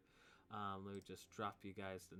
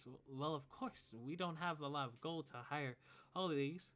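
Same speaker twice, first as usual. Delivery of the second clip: put-on higher, hesitant girl explaining; young woman's voice; 6 semitones higher than usual. Abigail explaining the necklace to her father, higher voice. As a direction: explain it sheepishly. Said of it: "She says".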